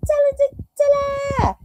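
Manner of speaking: high sing-song ta-da; chanting the ta-da up high. Retold or imitated. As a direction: announce it high like a fanfare. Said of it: "Darling Donna say".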